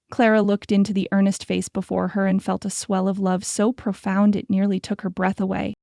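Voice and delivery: plain reading voice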